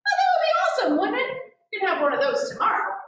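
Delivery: very high, bright burst of delight; delighted enthusiasm. Retold or imitated. Parent to child wanting popsicle, very high delighted enthusiasm. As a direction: gush as if it's the best idea ever. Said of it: "But you know your child".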